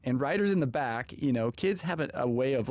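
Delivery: ordinary talk, nothing marked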